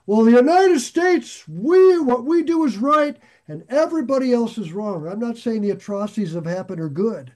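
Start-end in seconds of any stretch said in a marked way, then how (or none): 0.0-5.1 loud, high mock self-righteous declaration; mock-righteous proclamation, fading toward the end; self-righteous boasting. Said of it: "if we get into the mode of".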